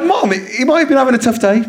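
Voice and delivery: fast, pleading defence; rushed defending. Retold or imitated. Son hurriedly defending Mister Tumble to his mum, fast. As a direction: hurry to defend him. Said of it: "I was like".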